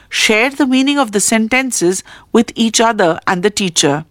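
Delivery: normal talking voice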